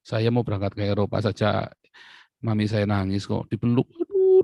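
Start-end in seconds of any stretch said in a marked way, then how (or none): 3.5-4.4 much higher, loud fond cry; hammed-up tearful aduh; mock fussing mother. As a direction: imitate mum clinging to him. Voicing his weeping mother.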